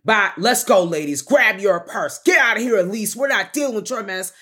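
loud, bossy dismissal; brother ordering out. Retold or imitated. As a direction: herd the ladies out and tell Elise off.

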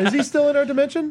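high, fast squeaky question; high mock voice. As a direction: squeak it out in jest. Joking high voice.

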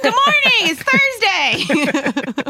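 high, loud, slow morning announcement; aggressive announcement. Retold or imitated. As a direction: bark out the school announcements. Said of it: "that's a little aggressive".

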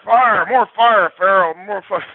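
hammed-up coach pounding on a player; coach yelling fire. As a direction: stretch each fire like a coach riding you. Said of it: "And it's all about fire".

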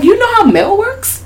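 disbelieving squeaky question; high incredulous. Retold or imitated. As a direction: ask it mockingly. Mocking question.

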